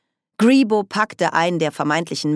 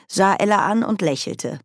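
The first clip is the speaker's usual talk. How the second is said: usual speaking voice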